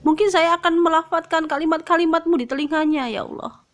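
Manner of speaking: high-pitched, earnest plea to God; pleading prayer. Retold or imitated. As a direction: retell a heartfelt bargain with Allah. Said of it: "Saya bertanya kepada Allah". (doa memohon dengan nada tinggi)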